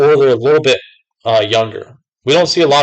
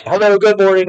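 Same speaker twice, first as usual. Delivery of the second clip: bright, higher hello to a regular customer; cheerful greeting; 8 semitones higher than usual. Retold or imitated. Worker greeting a regular customer, cheerful and high. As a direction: greet her with a lift in the voice. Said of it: "when I'm like".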